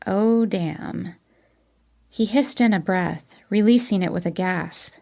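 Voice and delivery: ordinary delivery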